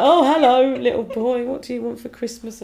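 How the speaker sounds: high put-on Christmas greeting; high voice, loud hello then softening; greeter to a child. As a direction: pipe up sweetly to a little child.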